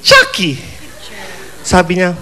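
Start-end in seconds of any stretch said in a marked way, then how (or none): 0.0-0.6 high, creepy doll voice; Chucky voice. Pretend. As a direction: squeak out the name like the killer doll. Answering as Chucky. (boses ni Chucky, matinis)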